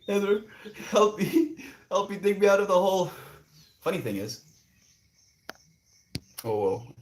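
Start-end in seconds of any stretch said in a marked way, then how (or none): 0.1-3.1 high, loud put-on cry for rescue; mock plea for help. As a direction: gasp and call out high, playfully desperate.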